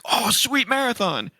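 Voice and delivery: high-pitched mock fanboy thrill; mock excitement. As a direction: gush it high, imitating hype. Mimicking a hyped announcement.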